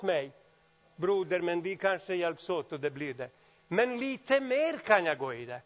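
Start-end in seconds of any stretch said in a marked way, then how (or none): none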